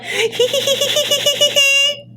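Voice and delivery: very high, squeaky hee-hee giggle; schoolgirl giggle. Retold or imitated. David giggling over Lana like a schoolgirl, very high. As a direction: giggle like a smitten cartoon schoolgirl. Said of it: "giggling like a schoolgirl, like Sailor Moon".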